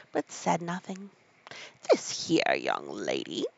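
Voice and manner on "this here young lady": very low, slow gruff-free Griffin. Acted. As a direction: drop the voice way down for the Griffin. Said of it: "said the Griffin".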